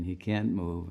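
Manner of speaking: normal talking voice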